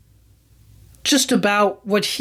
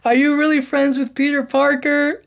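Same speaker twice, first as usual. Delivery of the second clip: mock Flash; 7 semitones higher than usual. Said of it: "Flash goes like".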